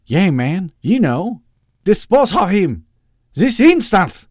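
put-on loud, high, Southern turning to evil accent; unsure villain soldiering on. Doctor Loeb soldiering on before Topper, building evil accent. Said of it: "uncomfortable and unsure, but he soldiered on".

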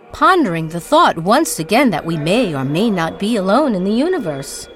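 ordinary delivery

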